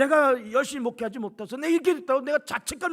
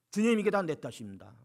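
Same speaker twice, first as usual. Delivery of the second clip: humble prayer; 7 semitones lower, 4 dB quieter than usual. Shown as a demonstration. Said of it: "세상 탓하지 마세요".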